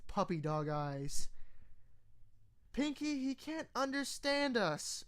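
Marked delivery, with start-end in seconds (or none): 2.7-5.0 higher, far-off brash pony voice; lightly voiced tomboy pony; Rainbow Dash voice. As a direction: tell her off flatly. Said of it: "Rainbow said".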